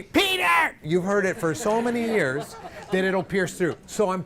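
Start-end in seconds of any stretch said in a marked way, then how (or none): 0.0-0.8 high, sudden piercing call; calling out a name. Shown as a demonstration. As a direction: call the name across a crowded room. Example of calling someone's name.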